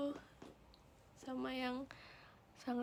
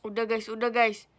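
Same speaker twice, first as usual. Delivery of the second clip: loud plea; 10 dB louder than usual. Real, not acted. Loud pleading to chat.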